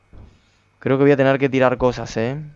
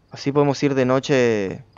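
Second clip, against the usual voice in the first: normal talking voice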